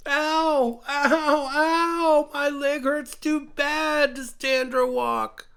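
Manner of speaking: high, loud whimpering ows, then a slow hurt line; high creature voice crying out in pain. A narrator's reading. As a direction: cry out like a hurt little animal. Injured creature cries in pain.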